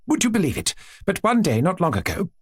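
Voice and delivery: a hint of a gushing princess; lightly voiced chatty princess. Princess telling her aunt about her children, lightly gushing.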